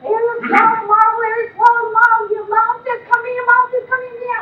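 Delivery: put-on high, loud, near-tears child's alarm; shrill-pitched retold cry for help; panicked child hollering. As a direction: imitate a frantic kid raising the alarm. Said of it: "she's almost crying, she's hollering".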